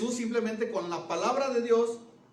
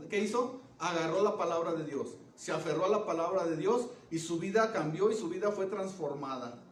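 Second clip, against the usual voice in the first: ordinary talk, nothing marked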